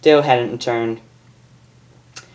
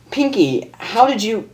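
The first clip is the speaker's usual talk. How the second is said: put-on higher girlish voice; high amazed question; Rainbow voice; 9 semitones higher than usual. Rainbow amazed at Pinkie, higher voice.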